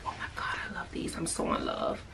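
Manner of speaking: whisper. Real, not acted. Trying on pants, hushed aside.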